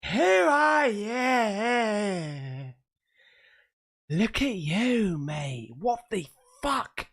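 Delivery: mock ghost voice.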